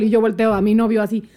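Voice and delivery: usual speaking voice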